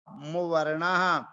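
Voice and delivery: "मुवर्णः" said slowly, every syllable clear; careful pronunciation. Shown as a demonstration. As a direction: spell out "मुवर्णः" slowly for students. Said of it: "इसका उच्चारण ध्यान से करना है". ("मुवर्णः" धीरे और साफ़ उच्चारण)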